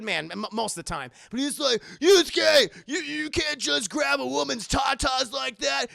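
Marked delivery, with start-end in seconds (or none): 2.0-5.9 high, loud mock reprimand; scandalized scolding. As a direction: shout the name, then scold in mock shock. Acted scolding of a friend.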